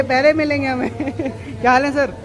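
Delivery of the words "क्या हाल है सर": ordinary delivery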